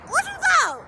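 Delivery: high-pitched teasing question; a high, teasing what-you-thought; incredulous taunt. Real, not acted. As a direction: toss it off, mocking. Taunting reaction after laughing.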